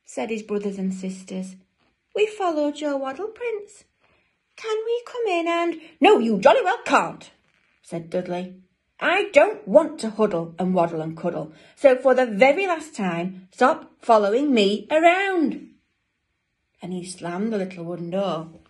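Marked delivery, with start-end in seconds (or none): 2.1-5.8 very high, louder pleading; eager penguin siblings. Acted. Siblings asking Dudley to come in, high pleading. 6.0-7.3 loud, high, cutting-in refusal; angry refusal. Acted. Dudley cutting off his siblings, loud angry refusal. 9.0-15.7 loud, emphatic, climbing higher; exasperated penguin. Acted. Dudley telling siblings to stop following, loud exasperation.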